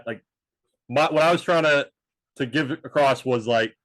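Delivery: ordinary delivery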